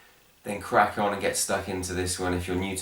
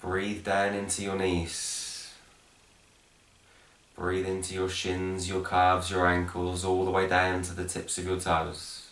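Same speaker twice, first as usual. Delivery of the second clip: guided meditation; 3 dB quieter, slower than usual. Acted. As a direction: guide them gently down into the breath, unhurried. Leads guided breathing meditation.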